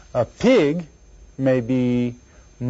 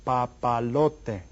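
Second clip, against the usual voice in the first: "papalote" slowly, each syllable apart; 3 dB quieter, much slower than usual. Shown as a demonstration. Teacher lets class figure out syllables, slow and separated. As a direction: draw out "papalote" for counting. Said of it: "See if you can figure it out".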